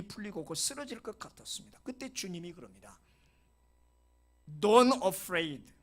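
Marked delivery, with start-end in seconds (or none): 4.6-5.7 loud call. Acted. Jesus speaks to grieving Jairus.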